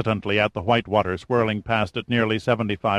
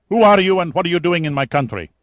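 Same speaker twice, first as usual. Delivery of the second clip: venomous, higher, louder, fast; viperous; 9 semitones higher, 6 dB louder, faster than usual. Acted. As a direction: demand answers with menace. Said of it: "his tone suddenly viperous".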